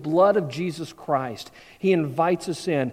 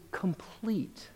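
slow whispered close; hushed whisper; 14 dB quieter than usual. Acted. Preacher whispering the promise to his listeners. Preacher closing the beatitude reading.

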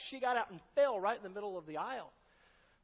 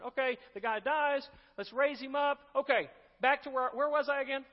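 mock Paul; 5 semitones higher, 6 dB louder than usual. Mocking Paul resuming his sermon.

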